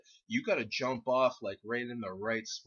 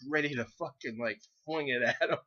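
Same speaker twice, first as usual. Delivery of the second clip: ordinary talk, nothing marked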